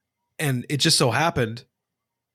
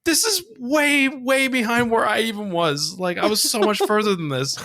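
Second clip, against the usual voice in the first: put-on dismayed kid; 10 semitones higher than usual. Kid finding an older save file, dismayed. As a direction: whine with hammed-up dismay.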